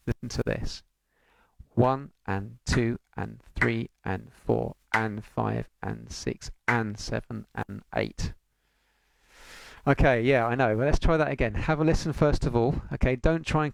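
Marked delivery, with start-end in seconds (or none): 1.7-8.3 slow, deliberate counting of each beat; one and two and three, spaced out and slow. Shown as a demonstration. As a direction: count it out slowly so they can follow. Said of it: "So I'm gonna slow things down".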